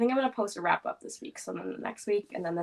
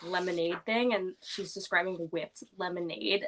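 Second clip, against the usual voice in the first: usual speaking voice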